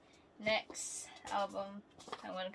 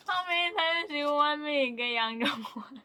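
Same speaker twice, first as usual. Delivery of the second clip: laughing complaint; 4 semitones higher, 9 dB louder than usual. Real, not acted. Complaining about repeat card.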